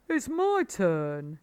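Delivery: lightly voiced wise badger. A narrator's reading. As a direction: savour the word. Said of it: "said Badger".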